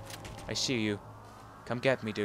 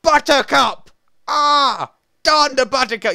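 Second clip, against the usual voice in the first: loud, higher fake swear with flower names; mock angry curse; 8 semitones higher, 7 dB louder, slower than usual. Gamer joking he swears by shouting flower names. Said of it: "I could go around and go".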